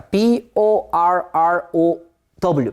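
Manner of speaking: slow, evenly spaced letters; teacher's deliberate letter-by-letter spelling. Shown as a demonstration.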